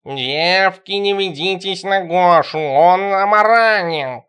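put-on slow talking cat warning the girls. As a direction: drag it out like a smug cat. Cat Tikhon voiced, warning girls.

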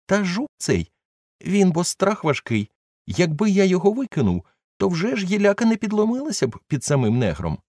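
high, sheepish servant excusing himself; Jupiter's voice. A narrator's reading. Old servant brushes off fear of the beetle, high and sheepish. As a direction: pitch up as the scolded old servant.

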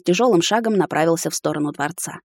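ordinary delivery, nothing marked

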